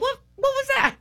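very high, astonished exclaim; startled surprise. Retold or imitated. Watching Derek Prince and reacting to the spirit of poverty, very high and startled. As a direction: jolt in surprise. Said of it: "I was like".

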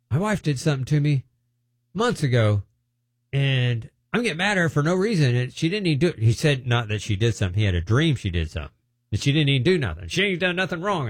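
a touch of an upset husband; earnest confession. Retold or imitated. Man confessing about wife.

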